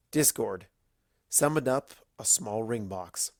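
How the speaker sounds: usual speaking voice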